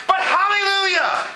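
high-pitched shouted turnaround; psalmist's sudden praise. Retold or imitated. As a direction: burst out in praise after complaining. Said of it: "And then he's like".

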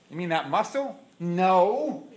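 ordinary talk

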